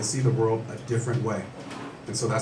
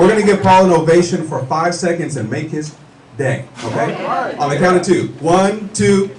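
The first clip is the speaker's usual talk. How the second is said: hype crowd rally; 5 semitones higher, 9 dB louder than usual. Real, not acted. Hyping crowd for Paul.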